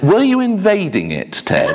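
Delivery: high start, falling away on the name; retold prompting question. Minister prompts old Ted about Sicily, high-pitched retold question.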